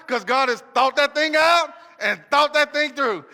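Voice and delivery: the refrain shouted out loud; preacher shouting his refrain. Acted. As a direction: hammer the catchphrase at the crowd.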